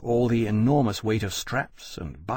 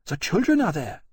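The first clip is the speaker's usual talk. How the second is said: queen's worry; 8 semitones higher than usual. A narrator's reading. Queen voiced.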